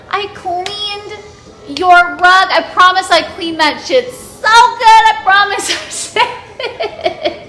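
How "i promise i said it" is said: high, loud apology through real laughter; laughing apology. Real, not acted. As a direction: apologize while cracking up. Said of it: "I'm laughing so hard".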